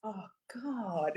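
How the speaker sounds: ordinary talk, nothing marked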